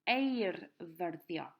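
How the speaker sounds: Eirfyrddio said very slowly, syllables stretched; slow model word. Shown as a demonstration. Teacher models Eirfyrddio very slowly for pupils to repeat. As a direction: draw Eirfyrddio out slowly for the class.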